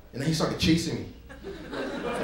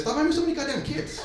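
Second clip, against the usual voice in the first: mock scolding note; 11 semitones higher than usual. Joke note read aloud.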